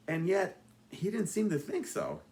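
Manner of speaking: ordinary delivery, nothing marked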